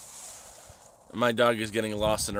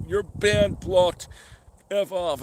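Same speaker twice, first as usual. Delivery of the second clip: higher, mocking imitation of a curt ban; mock dismissal; 8 semitones higher, 4 dB louder, slower than usual. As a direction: fire off the ban like a petty streamer. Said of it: "And he's like".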